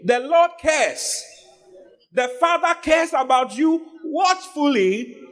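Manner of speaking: high, slow preaching voice; fervent sermon delivery. Acted. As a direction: lift the verse up to the church. Preacher reads and exhorts.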